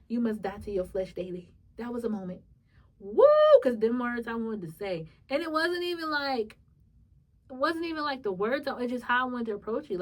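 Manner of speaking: ordinary delivery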